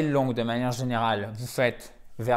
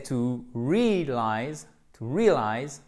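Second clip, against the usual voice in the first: "realize" slow, first syllable stretched and stressed; accent première syllabe; slower than usual. Shown as a demonstration. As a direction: draw out REAL in "realize". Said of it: "Accent tonique sur la première syllabe". (« realize » lent, début accentué)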